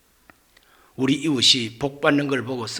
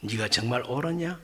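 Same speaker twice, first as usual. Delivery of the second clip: lower, softer searching question; God's quiet question; 6 semitones lower, 9 dB quieter than usual. Retold or imitated. God quietly questioning Jonah, soft and low. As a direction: ask it gently, almost hushed. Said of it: "하나님께서 말합니다".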